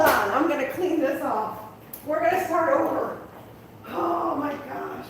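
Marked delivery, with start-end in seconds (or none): none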